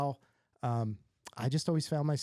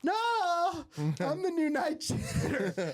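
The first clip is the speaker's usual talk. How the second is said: high, laughing, embarrassed confession; laughing admission; slower than usual. Retold or imitated. He tells his classmate his job, high embarrassed laugh.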